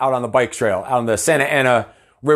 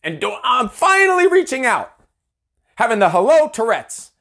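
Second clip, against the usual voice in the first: exasperated rant; 7 semitones higher than usual. Retold or imitated. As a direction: vent the frustration at being shunned. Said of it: "I'm like".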